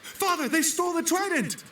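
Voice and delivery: high, urgent cry of bad news; breathless son reporting a theft; urgent alarm. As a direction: blurt it out in alarm.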